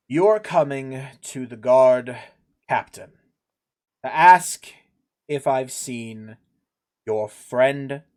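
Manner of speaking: slow, halting, weary disbelief; exasperated guard captain. Acted. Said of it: "he rubs his temples a little bit".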